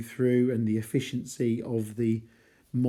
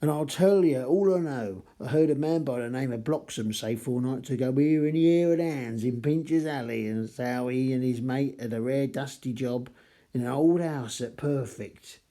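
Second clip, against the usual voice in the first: Cockney reading. Acted. Teacher reads the informant's Cockney line aloud, in a Cockney accent. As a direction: read it as a chirpy Cockney informant. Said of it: "attempts at replicating Cockney dialect".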